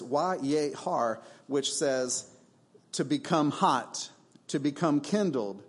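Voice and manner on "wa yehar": slow "wa yehar", stretched syllables; "wa yehar" carefully drawn out; Hebrew word. Shown as a demonstration. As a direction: say "wa yehar" slowly for the congregation. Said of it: "The second Hebrew word is".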